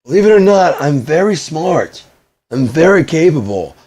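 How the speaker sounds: high, loud, exasperated outburst; getting mad. Real, not acted. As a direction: lose your temper and shout it out. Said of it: "I'm getting mad".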